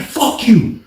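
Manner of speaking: high-pitched mock comeback; heated retold quarrel voice; retold angry retort. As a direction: imitate him cursing back. Retold exchange of curses.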